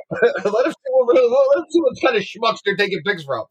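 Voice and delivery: high, giggling through words; laughing hard. Real, not acted. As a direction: crack up mid-sentence. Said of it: "I'm dying".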